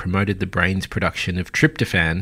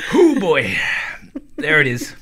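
hot sauce gasp; 7 semitones higher than usual. Real, not acted. Reacting to chili heat.

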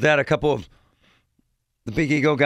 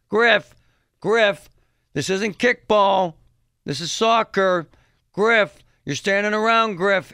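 high, raised-voice mock scolding; irate sideline mother; 6 semitones higher, 4 dB louder, slower than usual. Mother nagging Griff during a soccer game, mock angry yelling. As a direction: keep calling the kid out like a hollering parent. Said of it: "This is a mother".

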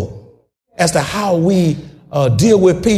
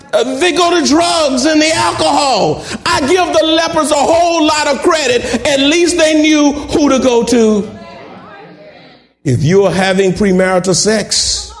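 normal talking voice

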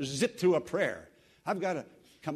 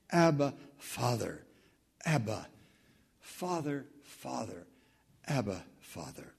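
fading from soft to barely there, lower; hushed tender prayer; 5 semitones lower, 3 dB quieter than usual. Real, not acted. Preacher praying to God, fading tender whisper-like prayer.

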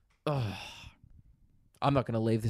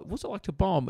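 mock curious; 7 semitones higher than usual. Imitating typical questions.

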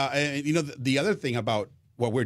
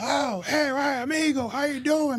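much higher, cheery greeting voice; a big, bright hello in a raised voice; imitating Mike Shannon. The veteran broadcaster greets his new partner, cheerful and high. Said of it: "He's like".

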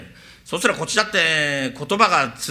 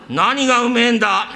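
father; 6 semitones higher, 5 dB louder than usual. Acted. Father answers.

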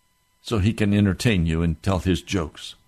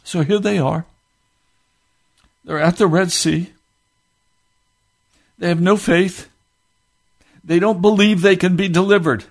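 raised, driving pulpit voice; fervent preaching; 11 semitones higher, 3 dB louder than usual. Acted. Preacher warning America of worse to come, fervent. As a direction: press the warning home.

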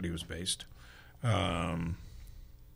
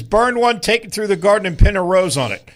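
loud, high kitchen call; diner order call; 7 semitones higher, 5 dB louder than usual. Retold or imitated. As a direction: bark the order like a cook.

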